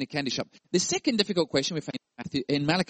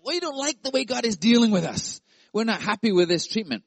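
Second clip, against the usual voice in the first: higher, louder whining complaint; spoiled complainers; 7 semitones higher, 4 dB louder than usual. Pretend. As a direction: sulk like a spoiled child. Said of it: "They were being spoiled".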